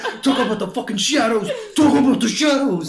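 high, loud, laughing, rising to a shout; mock film nerd craving. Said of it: "They're like".

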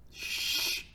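long, forceful "shh"; mock shushing. Preacher imitating church people silencing him, shushing. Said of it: "didn't want him to say nothing".

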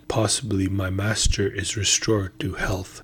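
ordinary delivery, nothing marked

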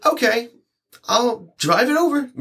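high, sing-song mock cheer; high-pitched chirpy "sure thing" voice; mock cheerful reply. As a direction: play it falsely chipper. Mocking own retold reply.

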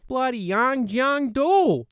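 angry shout. Acted. Australian airman cursing the island he escaped, shouting. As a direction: spit the island's name out in a slow Australian bellow.

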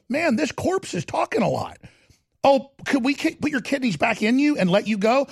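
high, casual mocking doctor voice; mock callous doctor. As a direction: sneer like a bored surgeon. Mocking doctors over a victim.

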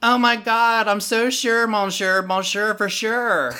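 put-on high, loud Valley Girl squeal; hammed-up Valley Girl with French words. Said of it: "I heard this Valley Girl speaking French".

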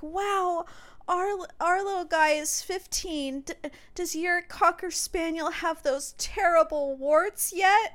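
high, loud overwrought dog owner; mock a worked-up lady, high-pitched and loud; hammed-up distress over a pet; distraught dog lady. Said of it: "she sounded really distraught".

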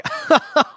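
loud high giggle; high laugh. Real, not acted. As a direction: laugh out nervously. Laughs at the robbery.

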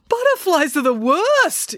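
high, slow outburst with worst leaned on; a fussy father blowing up over butterflies; exploding dad. Acted. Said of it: "exploded Dad".

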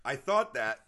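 coughing and sputtering on a drink; choking on coffee. Real, not acted. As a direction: laugh that chokes on coffee. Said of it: "Sorry, I'm choking on my coffee".

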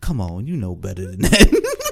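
low, creepy old-man imitation; creepy old geezer. Old man coaxes his aide, creepy geezer voice. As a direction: drop it low like a leering geezer. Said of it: "the creepy old geezer voice".

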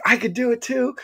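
high-pitched eager pipe-up; excited showing off. Retold or imitated. Guest retelling his urge to join the screaming, excited showing off. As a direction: pitch it up like an overkeen kid. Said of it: "I was super excited to show off".

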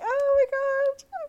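very high, slow squeal; flustered gasp. Retold or imitated. Fan flustered by the idol's compliment, squealing high. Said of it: "I was, like, blushing".

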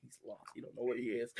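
hushed laughing aside to yourself; inner voice. Retold or imitated. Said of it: "in my ear, it's like".

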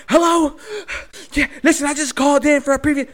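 high, loud, breathless panic to the dispatcher; yelling into the phone mid-chase; frantic call. Retold or imitated. Calling police mid-chase, frantic and loud.

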